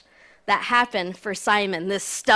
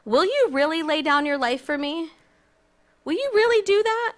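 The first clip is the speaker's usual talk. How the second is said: incredulous question; 6 semitones higher than usual. Retold or imitated. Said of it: "Then Jesus answered".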